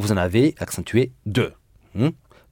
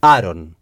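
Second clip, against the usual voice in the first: aron with a firm falling stress on -ron; stressed ending; 4 semitones lower, 4 dB louder than usual. Shown as a demonstration. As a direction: say aron clearly, landing on the end. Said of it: "on emploie la terminaison".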